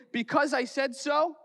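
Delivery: high mock mom retort; a parody of a stock parental answer. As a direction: snap it like an impatient parent. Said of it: "when my mom would say".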